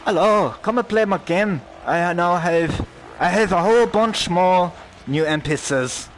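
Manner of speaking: high, loud mock London accent. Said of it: "in his London accent".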